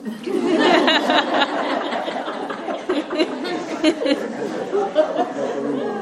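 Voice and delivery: long airy high-pitched laughter. Real, not acted. Monk laughs at the ghost giving lottery numbers, long and high. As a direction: break into a long delighted laugh.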